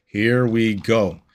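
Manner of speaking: slow, drawn-out hype voice; dramatic slow announcement. Pretend. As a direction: build it up like a big boss fight. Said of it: "same words said again differently".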